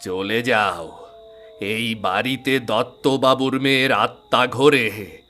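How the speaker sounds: put-on old caretaker warning the researchers away; ominous warning. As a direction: drag out the order to leave. Caretaker warns them off.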